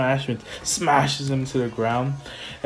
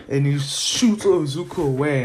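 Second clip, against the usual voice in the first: normal talking voice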